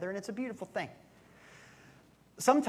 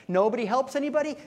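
louder, higher scoffing echo; mocking echo; 7 semitones higher, 5 dB louder than usual. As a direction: throw his words back with disbelief. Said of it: "Get a good B S detector".

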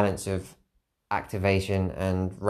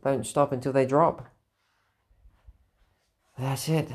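ordinary talk

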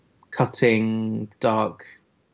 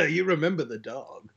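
laughing trailer voice; 3 semitones higher, 5 dB quieter than usual. Shown as a demonstration. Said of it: "the nineties trailer guy voice".

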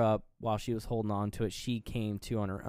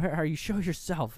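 raised, higher-pitched mock angry shout; angry demand; 6 semitones higher than usual. Heroine calling out the hiding villain, angrily. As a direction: imitate her angry calling out. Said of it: "and she's angry".

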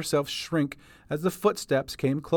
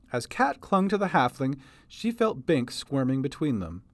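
plain reading voice

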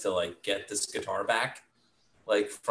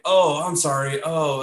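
higher, louder mock sorry; sheepish apology; 8 semitones higher, 6 dB louder than usual. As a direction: back off with a startled apology. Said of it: "And they were like".

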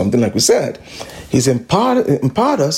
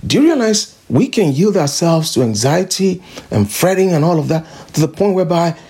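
ordinary talk

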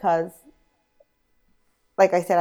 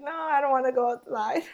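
whiny; 5 semitones higher, 5 dB quieter than usual. Retold or imitated. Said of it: "I'm like".

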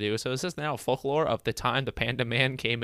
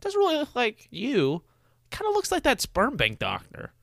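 mock picnic gossip; 11 semitones higher than usual. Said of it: "And they're like".

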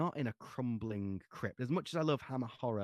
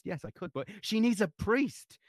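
high, loud, sudden cry; 11 semitones higher, 7 dB louder than usual. A narrator's reading. Desperate mother crying out to the priest, high and loud. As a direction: burst out at him. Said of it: "Chris cried out suddenly".

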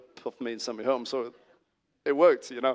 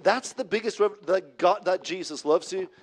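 higher-pitched, disbelieving question; incredulous; 5 semitones higher, 4 dB louder than usual. Acted. Young minister challenging the old minister's greatest revelation, incredulous.

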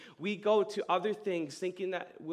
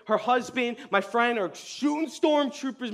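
ordinary talk

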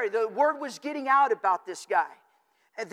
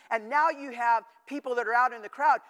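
ordinary delivery

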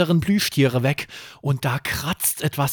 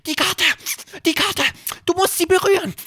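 urgent little rabbit; faster than usual. A narrator's reading. Hoppel urges Erik to touch the map, high and urgent. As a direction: squeak the warning in a hurry.

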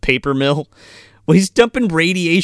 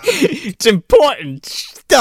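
mock solemn mayor; 11 semitones higher, 4 dB louder, much slower than usual. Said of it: "mayor's line".

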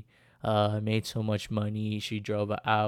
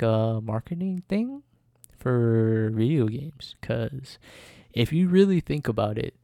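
ordinary talk

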